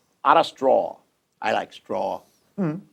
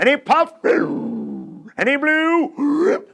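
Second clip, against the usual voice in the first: drawn-out blowing; 10 semitones higher, much slower than usual. Acted. Wolf blowing the house.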